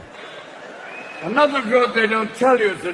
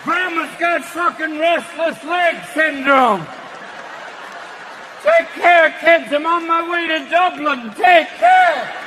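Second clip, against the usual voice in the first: mock alarmed yell; 4 semitones higher, slower than usual. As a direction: bellow it like a panicked relative. Family shocked, yelling goodbye.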